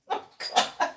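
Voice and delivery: usual speaking voice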